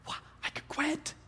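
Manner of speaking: put-on high, startled little-boy voice, pitched well up; surprised boy. Son amazed that dad lets him quit, high surprised. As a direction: sound like a kid who can't believe his luck. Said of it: "Totally surprised him".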